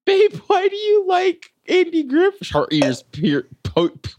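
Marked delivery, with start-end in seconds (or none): 0.0-2.5 very high sing-song pet voice; baby talk to dog. Pretend. Asking the dog Barley about Andy Griffith, high baby talk.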